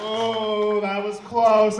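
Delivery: ordinary talk, nothing marked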